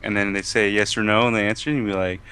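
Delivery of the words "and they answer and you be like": ordinary talk, nothing marked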